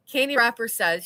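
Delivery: normal talking voice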